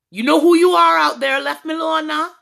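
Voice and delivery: calling out. Real, not acted. Calling out annoying DMer.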